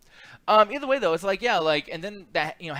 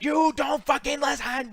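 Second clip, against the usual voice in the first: high, drawn-out mock scream, louder; imitated angry screaming; 10 semitones higher, 5 dB louder, slower than usual. Reviewer imitating the dad yelling at a little girl, mock screaming. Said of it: "and just screaming".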